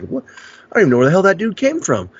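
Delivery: high-pitched bewildered friend; baffled. Retold or imitated. Voices friend's baffled reaction.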